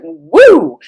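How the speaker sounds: mock celebratory woo; excited whoop. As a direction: cheer it out like big news. Big deal situation, it was like.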